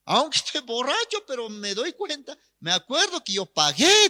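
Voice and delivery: put-on high, insistent drunk protest; lightly acted tipsy man defending himself; drunk young man. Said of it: "el joven borracho también dice".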